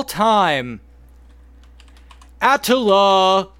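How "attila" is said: booming wrestling announcer. Acted. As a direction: draw it out like a ring announcer. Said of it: "was that your, uh, W W E announcer voice?".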